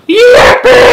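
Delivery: very high, very loud whoop; joyful yell. A narrator's reading. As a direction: explode with joy.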